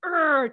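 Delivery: imitated surge of angry energy; angry growl. Shown as a demonstration. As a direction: let out an exasperated urgh. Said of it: "that energy that may have been going".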